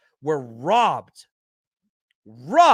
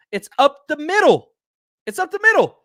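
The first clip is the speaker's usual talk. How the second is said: higher, louder, exasperated yell; frustrated shout; 8 semitones higher, 7 dB louder than usual. Real, not acted. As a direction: shout the point like you can't believe it. Fan ranting about coaching mistakes.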